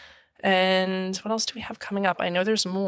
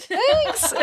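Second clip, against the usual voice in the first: a squeaky, much higher thank-you; mock-bashful thanks. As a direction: toss off the thanks way up high, playing coy. Bashful retold reply to compliment.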